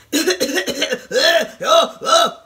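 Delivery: loud mocking hacking coughs; exaggerated repeated coughing, played for ridicule; horrible-voice imitation. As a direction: hack and cough in mockery of an awful voice. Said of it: "that horrible sounding".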